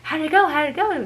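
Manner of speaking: high, loud, eager mock question, repeated; eager friends. Friends at school asking about her driving test, high and eager. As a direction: chirp it like an excited friend dying to know. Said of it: "everyone's gonna be like".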